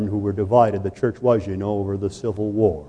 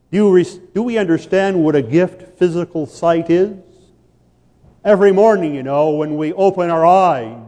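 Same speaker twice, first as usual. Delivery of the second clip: much higher, louder, fast at first; impassioned sermon, higher and louder; full-force preaching; 8 semitones higher, 5 dB louder than usual. Acted. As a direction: drive it home with fire.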